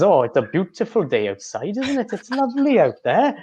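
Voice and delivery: sing-song Welsh lilt, pitch way up; Welsh accent. Retold or imitated. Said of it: "they sound like they're singing a song".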